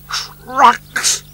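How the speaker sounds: high incredulous snort; scornful snort. Acted. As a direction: scoff at the word. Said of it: "snorted Sir Handel".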